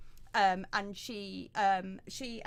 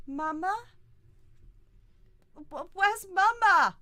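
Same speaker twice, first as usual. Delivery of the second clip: put-on high, loud crying child; small child calling for his mother; scared child voice; 9 semitones higher, 8 dB louder than usual. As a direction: wail for mama like a lost little kid. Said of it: "just looks up and just goes".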